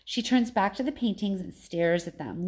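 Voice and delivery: usual speaking voice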